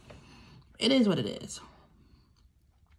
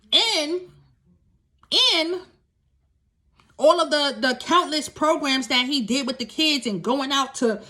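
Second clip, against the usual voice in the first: louder, higher, impassioned listing; indignant; 5 semitones higher, 5 dB louder than usual. Real, not acted. To the audience, recounting abuse at home, impassioned and loud. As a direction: pour out the grievances, rising.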